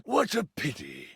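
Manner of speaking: slow, drawn-out villain threat; sinister royal drawl; menacing king. Acted. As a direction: feign pity, then threaten.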